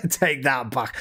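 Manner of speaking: slow, amused delivery with laughter; laughing. Real, not acted. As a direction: say it through a laugh. Laughing at an accidental pun.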